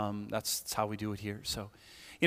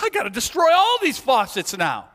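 high, loud mock-frantic yell; furious; 10 semitones higher, 7 dB louder than usual. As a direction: explode in over-the-top outrage. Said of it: "I'm furious".

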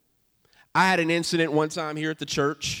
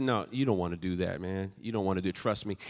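low, soft and calm; spoke softly; 7 semitones lower, 6 dB quieter than usual. Retold or imitated. Pastor calming an angry man outside church, softly. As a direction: settle him down gently. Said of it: "I spoke softly to him".

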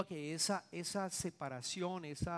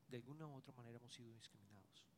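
quiet echo; 4 semitones lower, 16 dB quieter, faster than usual. Shown as a demonstration. Pastor echoing his point to the congregation, hushed. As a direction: repeat it much softer, fast. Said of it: "preacher echoes the line quietly".

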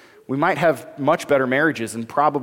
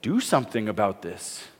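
lower, softer nudge; softer low mother's nudge; 8 semitones lower, 7 dB quieter than usual. Retold or imitated. Pastor retelling the mother prodding Jesus, softer and lower. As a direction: quietly push him to act. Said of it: "And his mother says".

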